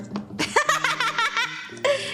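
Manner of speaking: long high-pitched giggle; real run of high laughter; amused laugh. Real, not acted. Tarot reader joking about flirting, giggling laugh.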